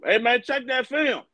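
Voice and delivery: calling out. Pretend. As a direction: yell up at the projectionist. Calling out to projectionist.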